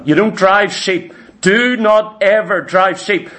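ordinary talk, nothing marked

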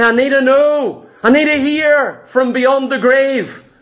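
high-pitched, insistent pleading to know; urgent demand. Acted. Saul demanding answers from the witch, urgent and high. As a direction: press each phrase out high and urgently.

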